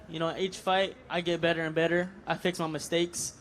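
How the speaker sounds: normal talking voice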